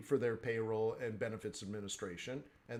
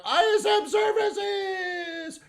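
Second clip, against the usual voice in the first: excited shout; 9 dB louder, much slower than usual. Real, not acted. Exclaiming a list climax.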